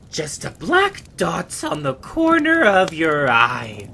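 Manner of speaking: taunting madman. Acted. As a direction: threaten him like a lunatic. Dying villain's taunt.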